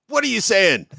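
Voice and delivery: mock disbelief, catching someone out; incredulous wife. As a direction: teasingly challenge a spouse's odd pronunciation. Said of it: "And she's like".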